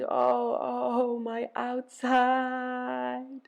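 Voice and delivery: high, long-drawn moan. A narrator's reading. Ereshkigal moaning over her outside, high drawn-out moan. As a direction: stretch the words into a slow high wail. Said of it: "She moaned".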